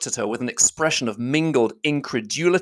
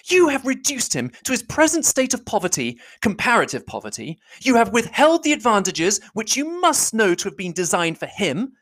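higher, forceful accusing voice; Elizabeth crying out with energy; 11 semitones higher than usual. Acted. Elizabeth crying out at Darcy, higher and forceful. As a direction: fling the charge at him, higher and forceful. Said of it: "cried Elizabeth with energy".

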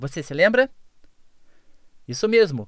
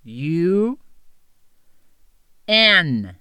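each letter slowly, long pauses between; spelled slower; 2 semitones lower, much slower than usual. Shown as a demonstration. Teacher repeating the spelling for listeners, slowly and deliberately. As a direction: spell it out slowly for note-taking. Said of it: "Mais devagar".

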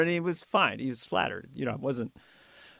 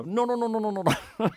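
higher, rapid motorboat no's; Lorne's backpedaling; 5 semitones higher, faster than usual. Retold or imitated. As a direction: rattle off the no's quickly. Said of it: "backpedaling motorboat sound".

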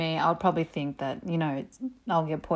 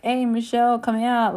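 friends calling out; 5 semitones higher, 5 dB louder than usual. Retold or imitated. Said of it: "my friends were messaging me like".

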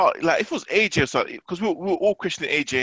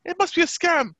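much higher, whiny impression of wife; high mock wife voice; 10 semitones higher than usual. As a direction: mimic her insisting on a fake. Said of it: "She's like".

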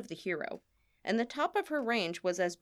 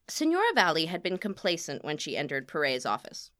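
plain reading voice

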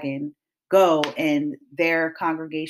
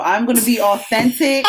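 laughing; 6 semitones higher, 7 dB louder than usual. Real, not acted. Laughing confession about cursing.

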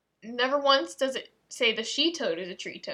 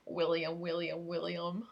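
droning didgeridoo; 5 semitones lower, 6 dB quieter than usual. Shown as a demonstration. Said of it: "sounds like a didgeridoo".

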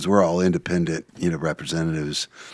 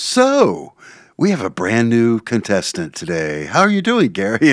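cheery host voice; 5 semitones higher, 6 dB louder than usual. Retold or imitated. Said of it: "the lady who does admin for him goes".

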